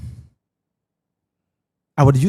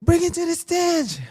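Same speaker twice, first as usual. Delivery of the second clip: hyped MC; 13 semitones higher than usual. Retold or imitated. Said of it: "He said".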